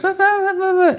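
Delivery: put-on very high garbled toddler babble; high-pitched little kid. Toddler answering his hard-of-hearing dad, high-pitched babble. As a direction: babble unintelligibly in a tiny falsetto. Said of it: "he's got a really high-pitched voice".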